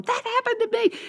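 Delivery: very high squeal of recognition; high imitated outcry; exclaiming teachers. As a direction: squeal it like a fellow sufferer. Voices other teachers exclaiming.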